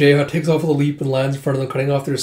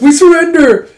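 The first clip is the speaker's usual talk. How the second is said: much higher, loud panicked shout; shouted surrender; 12 dB louder than usual. Acted. Soldiers begging the warriors, panicked shout. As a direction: scream it out while bowing down.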